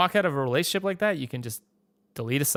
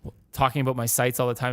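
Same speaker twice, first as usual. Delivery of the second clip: ordinary talk, nothing marked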